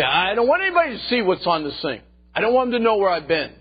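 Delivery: high, loud protest in someone else's voice; acted secretive objection. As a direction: exclaim it like someone dreading being found out. Voicing person hiding browsing history.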